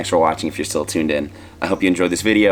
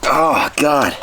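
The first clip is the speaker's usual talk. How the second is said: spicy pain; 5 semitones higher than usual. Real, not acted. Burning mouth, still worse.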